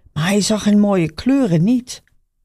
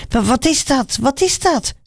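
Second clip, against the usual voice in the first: high, alarmed stammer; startled, asking around in the crowd; startled call; 7 semitones higher than usual. A narrator's reading. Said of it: "riep Bartimaeus". (verschrikt, hoog geroepen)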